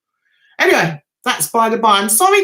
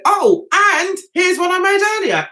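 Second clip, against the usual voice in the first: higher, playful reveal of a gift; showy presenting; 6 semitones higher, 3 dB louder than usual. Retold or imitated. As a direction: announce it like a little surprise. Re-enacting presenting his t-shirt.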